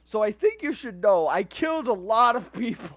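loud, hammed-up breakdown voice; mock frantic confession. Killer calling his lawyer, mock frantic. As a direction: act a panicked confession, loud and high at peaks.

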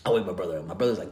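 ordinary talk

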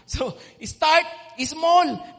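very slow, loud "start small"; drawn-out refrain. Real, not acted. Preacher hammering home the main point, slow and loud. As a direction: stretch the refrain out. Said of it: "sermon refrain".

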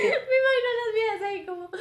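laughing. Real, not acted. Friend picturing the girls hiding behind trees, laughing. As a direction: giggle it out, picturing the scene. Laughing while imagining scene.

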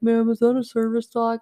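a hint of a gruff store greeter challenging; mock stern question from the greeter. Walmart greeter stops her at the door, mildly stern. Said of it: "stopped me and yelled at me".

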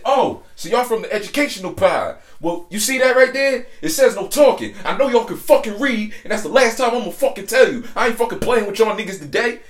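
hammed-up high-pitched, loud tirade; retold angry scolding. Woman scolding inmates for talking, retold as a loud high rant. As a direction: imitate her telling them off, high and loud.

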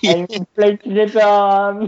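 proud fake French boast; French accent. Boasting to his friend as a Frenchman, mock French accent. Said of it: "speaking in the French accent".

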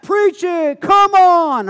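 high, loud shout of "Preach it"; yelling back. Retold or imitated. High schoolers shouting back at the pastor, loud and high. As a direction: holler encouragement at the preacher. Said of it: "so they're yelling back".

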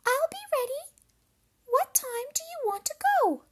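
very high, loud squeaky voice; agreeable little pig. A narrator's reading. Pig agrees to the wolf's plan, high squeaky voice. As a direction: pipe up as a tiny piglet. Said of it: "said the little pig".

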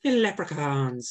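quick go at a Northern Irish lilt; mock Northern Irish; Northern Irish accent. Shown as a demonstration. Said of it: "I think the Northern one is the".

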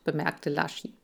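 ordinary delivery

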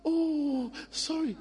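high soft coddling oh sorry; fussing parent. Retold or imitated. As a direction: coo like a worried parent. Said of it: "But if you say".

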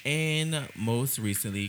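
ordinary delivery

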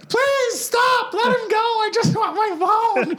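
very high, slow mock shriek with a laugh; frantic girlfriend. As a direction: plead it shrilly like a hysterical girlfriend.

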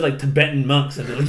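usual speaking voice

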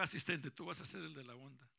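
ordinary delivery

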